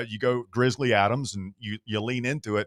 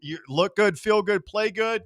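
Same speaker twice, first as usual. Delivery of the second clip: gym coach; 9 semitones higher than usual. Pretend. Coach slogan recited.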